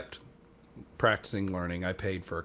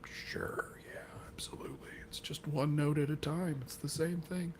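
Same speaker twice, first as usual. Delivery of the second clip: mock-confident whisper, barely any voice; whispered bluff; 7 semitones higher, 8 dB quieter than usual. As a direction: whisper it like an unconvincing yes. Bluffing reply to band's question.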